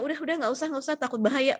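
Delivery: high-pitched, repeated waving-off; acted worried caregiver stopping someone; overprotective fussing. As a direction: fuss protectively, pitched well up.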